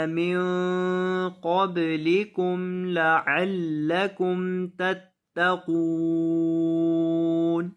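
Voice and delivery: slow, drawn-out tajweed chanting; Quran recitation. Shown as a demonstration. Teacher reciting the verse for students, slow chant. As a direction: recite the verse slowly with long held vowels.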